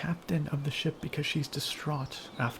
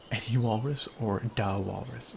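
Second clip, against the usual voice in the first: ordinary talk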